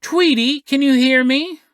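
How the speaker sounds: lightly acted doctor testing for a response. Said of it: "He goes".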